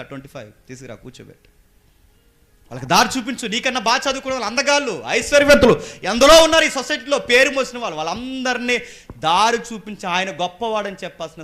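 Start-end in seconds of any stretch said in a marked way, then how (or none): 2.7-11.4 raised, ringing sermon list; full-force preaching. Real, not acted. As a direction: exhort the congregation, high and loud. Preacher exhorts congregation.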